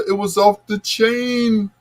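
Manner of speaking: chain said high and far from his own voice; Bill Bellamy voice. Retold or imitated. DJ redoing food praise as Bill Bellamy. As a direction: say it was off the chain way up, comic style. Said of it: "In my, uh, Bill Bellamy voice".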